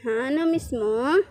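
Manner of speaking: ordinary talk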